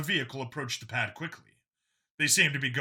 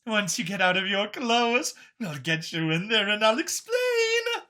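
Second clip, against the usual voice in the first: much higher, bubbly alien scientist; excitable Xeno; 11 semitones higher, 4 dB louder than usual. Acted. Xeno caught setting up the suit, high and eager. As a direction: play the eager grinning Xeno.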